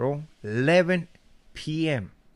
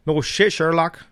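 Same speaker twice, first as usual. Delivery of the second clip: loud, slow sarcastic retort; loud sarcastic jab at an obvious point; sarcastic no shit; 5 semitones higher, 8 dB louder than usual. Retold or imitated. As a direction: drag it out mockingly.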